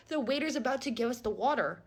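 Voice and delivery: ordinary talk, nothing marked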